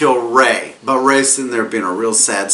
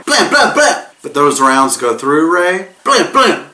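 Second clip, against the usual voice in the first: gunshot sounds; 3 semitones higher, 3 dB louder than usual. Pretend. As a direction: fire off the shots. Gunshot imitation.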